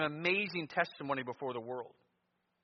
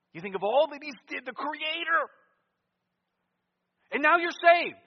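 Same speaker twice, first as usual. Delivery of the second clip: loud, high-pitched pulpit cry; impassioned shout to the congregation; full-force preaching; 13 semitones higher, 12 dB louder than usual. Real, not acted. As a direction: preach it at the top of your voice, building.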